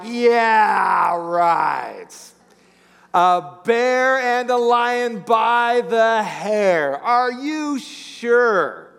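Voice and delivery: very slow, drawn-out disbelief; sceptical parent. Pretend. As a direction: humour the kid's tall story. Parent doubting kid's tall tale.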